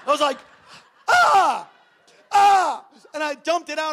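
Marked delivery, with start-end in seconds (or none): 1.1-2.8 two loud, high "ah" cries; mimed outburst at being caught; frustrated yell. Retold or imitated. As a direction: yell out in exasperation. Mimes frustrated outburst when caught.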